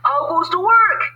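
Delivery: high, loud farmer call. Acted. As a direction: bellow it as the lazy farmer. Farmer calls from bed.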